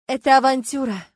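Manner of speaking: sighing protest, loud on the first word. A narrator's reading. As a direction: object with a sigh. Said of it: "вздохнула Люба". (со вздохом, с сомнением)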